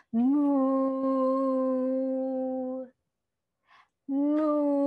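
playful mooing like a silly cow; hammed-up cow moo. Shown as a demonstration. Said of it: "can you be a silly cow?".

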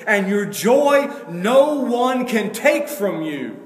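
loud, high, driving sermon voice, slowing on the scripture; full-force preaching. Acted. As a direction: preach it out to the whole church.

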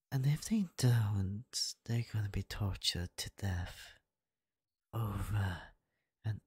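sly menacing whisper; demonic whisper. Acted. Threat whispered to bride.

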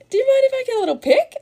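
very high, bright and loud, overly polite; sweet fangirl ask. Retold or imitated. Fan asking her childhood celebrity for a photo, high and giddy. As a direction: squeak out the request like a nervous fan.

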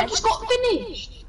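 high shocked cry; outraged cry. Real, not acted. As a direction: yelp in disbelief. Knocked out in game.

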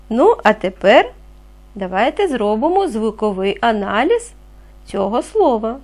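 ordinary talk

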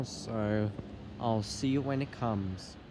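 ordinary talk

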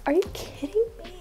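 ordinary talk, nothing marked

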